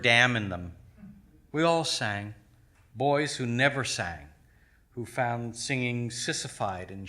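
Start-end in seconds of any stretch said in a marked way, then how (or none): none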